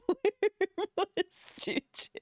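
high, very slow, drawn-out laughing repeat; helpless high-pitched laughing question; laughing wail. Real, not acted. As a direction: stretch it out high through laughter.

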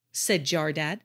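plain reading voice

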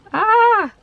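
sudden high yelp; surprised yelp. Real, not acted. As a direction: let out a startled ah. Sudden cry.

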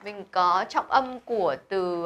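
ordinary talk, nothing marked

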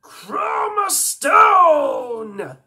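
very slow, high, loud held shout; transformation cry. Acted. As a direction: stretch the name into a huge yell. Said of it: "some Ben Ten alien voices".

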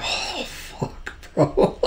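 ordinary delivery

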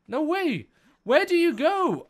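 high, incredulous cry of no way; stunned, high-pitched protest; shocked disbelief. Real, not acted. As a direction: cry out as your prey escapes. Creature flies off again.

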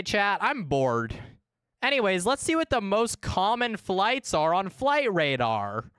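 ordinary delivery

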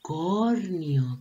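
long drawn U opening "Unicornio"; stretched first vowel. Shown as a demonstration. Teacher showing children the opening vowel, stretched "Unicornio". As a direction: sound out "Unicornio", lingering on the U. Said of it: "que también comienza con la vocal".

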